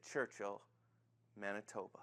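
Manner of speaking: very low, soft, reverent murmur; low, awed near-whisper; hushed wonder. Acted. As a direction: breathe the name quietly, with a pause. Ed quietly repeats in wonder.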